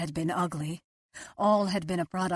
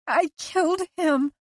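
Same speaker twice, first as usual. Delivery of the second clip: much higher and louder, desperate; anguished, louder; 11 semitones higher, 5 dB louder than usual. A narrator's reading. Liz insisting to Owen, louder anguish. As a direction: repeat it harder, breaking.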